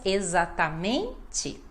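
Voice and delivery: "e-xa-ta-MEN-te" carefully separated, long MEN; all syllables pronounced. Shown as a demonstration. Teacher telling learners to say every syllable, measured "exatamente". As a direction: spell out each syllable of "exatamente". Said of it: "Pronunciar todas as sílabas".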